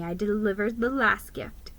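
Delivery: ordinary delivery, nothing marked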